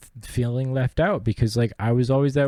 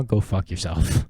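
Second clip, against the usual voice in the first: defiant dismissal; 3 semitones lower than usual. Real, not acted. Answer to people who doubted him.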